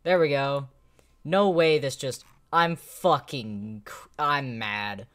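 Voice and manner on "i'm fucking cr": ordinary talk, nothing marked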